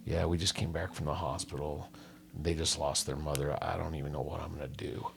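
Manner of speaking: broken, hushed apology; crying dad. Retold or imitated. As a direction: speak like a grieving father near tears. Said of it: "He's crying".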